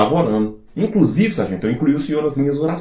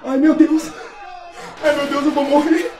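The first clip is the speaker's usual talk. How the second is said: panicked screaming; 10 semitones higher, 3 dB louder than usual. Acted. Soldiers panicking in raid.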